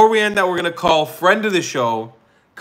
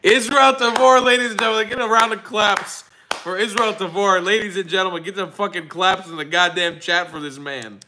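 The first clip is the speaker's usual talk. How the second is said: announcer send-off; 4 semitones higher than usual. Acted. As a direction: hype the crowd to clap for him.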